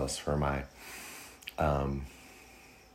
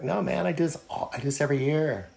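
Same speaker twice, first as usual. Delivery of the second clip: mock reassurance; 9 semitones higher, faster than usual. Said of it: "And I was like".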